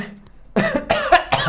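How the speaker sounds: sudden hard cough; choking cough. Real, not acted. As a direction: cough like something went down wrong. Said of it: "Ó, até tossiu aqui".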